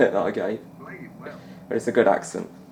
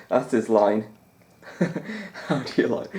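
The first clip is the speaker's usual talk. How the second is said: normal talking voice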